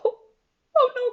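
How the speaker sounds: high, loud wailing oh no; dismayed cry. A narrator's reading. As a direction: cry out in despair. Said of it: "cried George".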